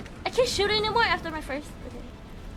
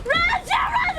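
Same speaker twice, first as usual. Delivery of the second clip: frantic shout; 14 semitones higher than usual. Real, not acted. Cheering character to flee.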